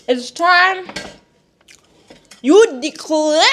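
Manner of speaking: high, loud call to action; rally them to declare it; rousing shout. Real, not acted. Pep talk to viewers.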